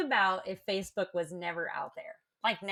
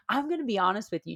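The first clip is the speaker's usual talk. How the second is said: ordinary talk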